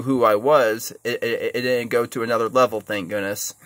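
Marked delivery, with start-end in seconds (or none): none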